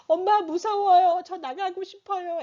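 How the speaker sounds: put-on high whiny child's plea; scared child. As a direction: plead like a frightened little kid. (겁먹은 아이 목소리)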